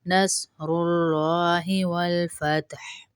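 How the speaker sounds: whispered slowly, no voice. Shown as a demonstration. Teacher whispers the verse during the drill. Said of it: "drill repetitions, then whispered".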